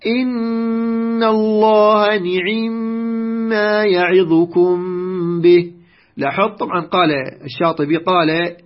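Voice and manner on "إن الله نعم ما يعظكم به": measured Quran recitation, clear ni-'i-mma in نعم; kasra on nun and ayn. Shown as a demonstration. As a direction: chant the verse slowly, نعم as ni'imma with a full i on both nun and ayn. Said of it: "كسر النون وكسر العين".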